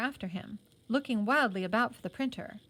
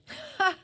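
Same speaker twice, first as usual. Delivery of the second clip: high, sudden laugh he can't hold back; a short helpless laugh; burst of laughter; 4 dB louder than usual. A narrator's reading. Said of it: "Then Johnny laughed".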